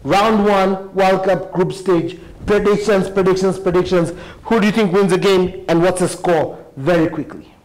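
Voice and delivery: upbeat announcer opening the episode; bright podcast host welcome over music; show host intro. Acted.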